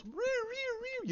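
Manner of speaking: high-pitched, drawn-out droid whirring. Retold or imitated. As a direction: imitate a little robot's squealing chirps, very high. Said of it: "and R two goes".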